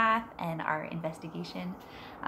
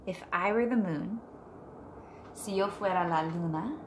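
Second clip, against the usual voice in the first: put-on reading to children; 6 dB quieter than usual. Teacher reading Moon Wishes to her class, gentle storybook voice. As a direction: read it softly to a class of kids. Reads picture book aloud.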